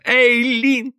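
high, insistent call through a door. A narrator's reading. Husband gets no answer from behind the door, calling out. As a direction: call the name out, pitch well up.

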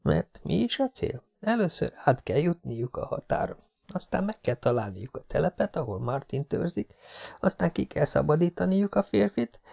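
put-on high, hushed, fretful young woman; anxious girl's inner voice. A narrator's reading. Jessica fretting before the border crossing, high and hushed. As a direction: worry it through under your breath.

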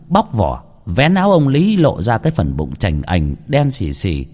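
ordinary delivery

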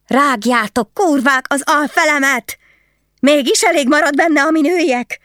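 very high, furious shrieking; shrieked retort. A narrator's reading. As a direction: shriek the curse back at them at full pitch. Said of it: "visította Amaz vissza rájuk".

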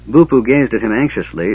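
usual speaking voice

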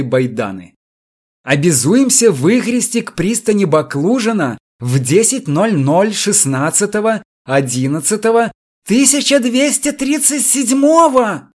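can't believe what you're reading; excited outrage. A narrator's reading. Supplier grabbing the electrician to read it, agitated.

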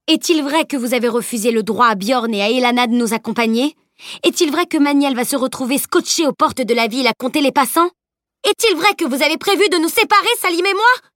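mounting accusation, peaking in a cry; rising to a cry. A narrator's reading. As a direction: start firm, end high-pitched in outrage. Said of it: "allé croissant pour finir sur un cri".

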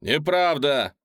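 loud, high heckling cry; shout from the crowd. A narrator's reading. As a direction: shout him down. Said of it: "раздался голос из толпы".

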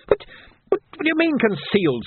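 high-pitched outraged protest, little added volume; screaming. A narrator's reading. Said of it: "screamed the twisting prisoner".

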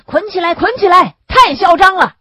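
high, loud cries from the crowd; outraged heckling shout; shouting activists. A narrator's reading. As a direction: clamour for him to be tied up. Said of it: "积极分子就喊着".

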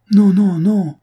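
high, loud burst of protest; vehement cry. Acted. Maria protesting to her sister, vehement cry. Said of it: "s'écria Maria avec véhémence".

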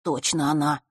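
ordinary delivery

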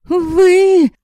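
high, loud indignant cry; outraged girl. Acted. Yaska crying out at the vampire, high and loud. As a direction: burst out at him.

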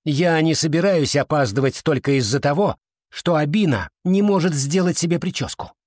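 indignant call. A narrator's reading. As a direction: complain impatiently to a friend, voice climbing. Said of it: "и крикнула". (возмущённо, повышая голос)

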